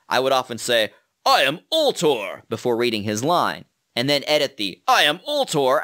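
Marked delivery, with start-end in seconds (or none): 1.2-2.4 slow, booming hero declaration, pitched up; exaggerated hero. Shown as a demonstration. Voice actor setting up a hero character, exaggerated heroic voice. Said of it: "who is this exaggerated hero character". 4.9-5.8 louder, higher heroic proclamation; exaggerated hero. Shown as a demonstration. Voice actor repeating a hero's set-up phrase, exaggerated heroic voice. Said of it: "who is this exaggerated hero character".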